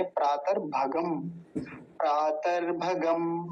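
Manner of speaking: प्रातर said slowly with a clear rolled r; slow, deliberate recitation; careful pronunciation. Shown as a demonstration. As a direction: sound out प्रातर भगम slowly, syllable by syllable. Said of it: "इसको ध्यान रखेंगे".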